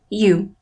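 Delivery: "you" with the voice falling; weaker, falling "you". Shown as a demonstration. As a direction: let "you" drop away at the end. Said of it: "e se abaixou na última palavra".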